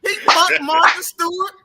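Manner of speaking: high, loud laughing rant. Real, not acted. Laughing rant about [name].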